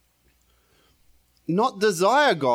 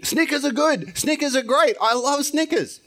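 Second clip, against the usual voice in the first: much higher, gushing over Snickers; excited craving; 8 semitones higher than usual. Retold or imitated. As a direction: rave about the candy bar, pitched way up. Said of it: "I get excited".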